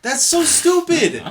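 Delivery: loud, high, drawn-out disbelief; incredulous outburst. Real, not acted. Co-host reacting to a silly movie accent, incredulous outburst. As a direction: cry out in outraged amusement. Outraged on hearing of Russian accent.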